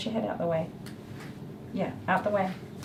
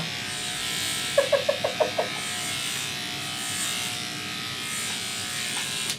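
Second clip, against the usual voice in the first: long high laugh; 8 dB quieter than usual. Real, not acted. Laughing at memory.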